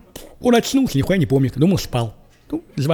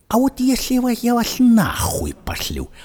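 slow, high, breathless whisper; mock whisper; 7 semitones higher, slower than usual. Hero whispering a question to the agents, slow and hushed. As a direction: hiss it out slowly like a scared man.

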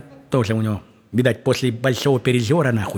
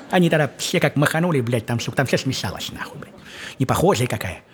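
ordinary talk, nothing marked

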